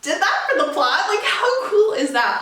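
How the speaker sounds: laughing, loud and high; delighted squeal of approval; thrilled laugh at the twist; excited laughing. Retold or imitated. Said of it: "I was like".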